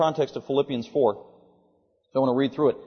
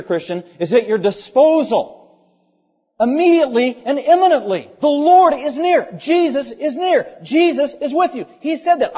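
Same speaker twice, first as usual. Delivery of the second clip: high, loud preaching, stretched key words; fervent preaching; 8 semitones higher, 6 dB louder than usual. Real, not acted. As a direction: proclaim it at full force to the congregation.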